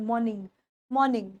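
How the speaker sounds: मॉर्निंग with a silent r, low and soft; quiet model of मॉर्निंग without the r; correct pronunciation. Shown as a demonstration. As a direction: say मॉर्निंग as "maw-ning", dropping the r. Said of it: "मॉर्निंग बोलना है".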